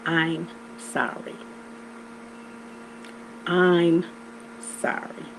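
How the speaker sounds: "I'm sorry" mouthed slowly, every sound crisp; over enunciating. Shown as a demonstration. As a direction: spell out "I'm sorry" slowly, lips exaggerated. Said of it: "Watch my lips".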